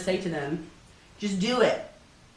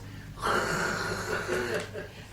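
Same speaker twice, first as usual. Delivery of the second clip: long voiceless imitated spray; fire hose whoosh; 7 dB quieter than usual. Organizer showing catalogs pouring in like a fire hose, imitated whoosh. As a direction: imitate gushing water with breath alone.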